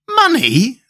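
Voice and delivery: the friends amazed at Tip's idea. Acted. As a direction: gasp it out in disbelief. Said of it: "cried in an amazed chorus".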